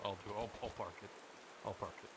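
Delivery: ordinary talk, nothing marked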